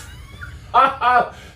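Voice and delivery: short high laugh at oneself. Real, not acted. Streamer admitting a rubbish American accent, laughing.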